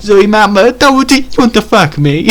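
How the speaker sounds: high, loud hammed-up female character; mock damsel voice. Chico in her rescuer's arms propositions him, high mock-girl voice. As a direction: squeal it as a smitten rescued girl.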